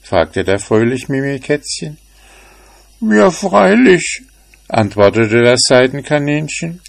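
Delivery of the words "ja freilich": much higher, frightened little rabbit voice; small scared bunny, anxious and pleading; timid rabbit. A narrator's reading. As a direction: squeak out your fear of the cat. Rabbit answers.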